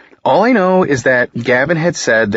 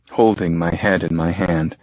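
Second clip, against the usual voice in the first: plain reading voice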